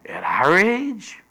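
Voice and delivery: high, slow disbelief on age; incredulous. Retold or imitated. Sarah doubting a child in old age, high and incredulous. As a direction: stretch it out in disbelief. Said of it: "Sarah said".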